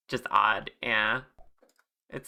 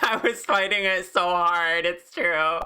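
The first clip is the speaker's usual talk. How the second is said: laughing; 7 semitones higher, 4 dB louder than usual. Real, not acted. Laughing about giving in.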